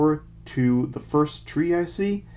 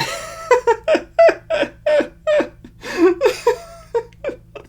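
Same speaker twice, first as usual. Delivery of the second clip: loud laugh; 5 dB louder than usual. Real, not acted. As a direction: burst of high loud laughter. Laughs at drawing named Butt.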